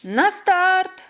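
loud, high starting call; a mole shouting the start for the sled race; shouting command. A narrator's reading. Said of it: "прокричав Мурчик".

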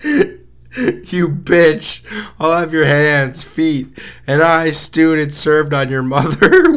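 high, loud, slow anguished yell; pained scream. Acted. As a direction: scream it through the pain. Cloaked figure screams in pain.

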